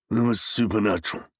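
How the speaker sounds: harsh and muffled, a bit fast. A narrator's reading. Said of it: "in a harsh, muffled voice".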